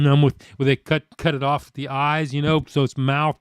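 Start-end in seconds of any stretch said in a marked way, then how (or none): none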